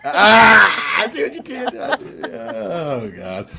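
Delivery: laughing at a pun; laughing groan. Real, not acted.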